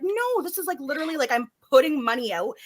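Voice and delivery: high-pitched, put-upon complaint; exasperated protest. Retold or imitated. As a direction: protest it, starting high on a long no. Retold protest over broken dryer.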